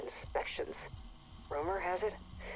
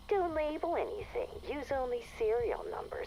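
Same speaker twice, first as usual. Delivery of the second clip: condescending mock quote; 8 semitones higher than usual. Station chief mocking her boss's protocols, condescending mimicry. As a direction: parrot her orders with a sneer. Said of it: "Utterly condescending".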